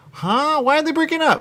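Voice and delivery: high-pitched puzzled viewer impression; mock bafflement. Host voicing viewers reacting to a breakup, mock bafflement.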